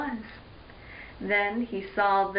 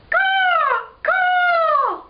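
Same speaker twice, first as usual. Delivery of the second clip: very high, loud creature screech; lizard caw; 7 dB louder than usual. Acted. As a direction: screech like a swooping flying lizard. Imitating swooping lizards' screeches.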